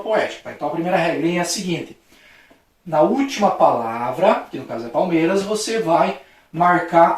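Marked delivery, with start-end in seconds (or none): none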